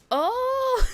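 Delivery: loud, high-pitched "oh!"; delighted realization cry. Retold or imitated. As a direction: burst out with a high cry of recognition. Retells sudden delighted realization.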